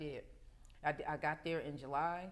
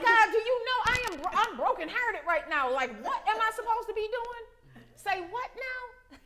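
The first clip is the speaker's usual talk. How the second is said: high, loud, teary cry to God; tearful plea; 11 dB louder than usual. Retold or imitated. Woman crying out to God in her car, loud and teary. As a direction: plead through tears, voice cracking high.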